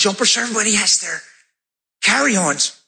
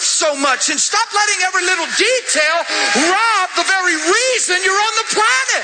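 higher, building exhortation; rising preaching; 9 semitones higher than usual. Real, not acted. Preacher urging the crowd to love people, building. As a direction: preach it home to them.

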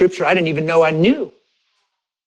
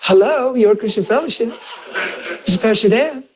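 bright and composed, higher pitched; cheery phone greeting; 5 semitones higher than usual. Retold or imitated. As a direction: sound all good though you've been crying. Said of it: "and now I sound all good".

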